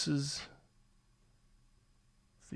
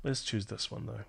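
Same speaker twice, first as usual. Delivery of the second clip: ordinary talk, nothing marked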